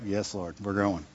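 low, very soft retold reply; meek surrender. Father gives in to God about going out, meek and quiet. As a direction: trail down into quiet, obedient defeat.

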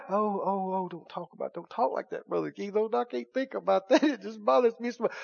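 mock fearful whine. Preacher mimicking frightened people begging him not to talk of death, mock-fearful and soft. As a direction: whimper it like a scared churchgoer.

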